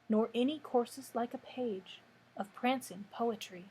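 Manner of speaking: measured poem recital, pausing after each line; poetry reading. Acted. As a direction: read the verse unhurriedly, letting line endings fall away. Reads a poem aloud.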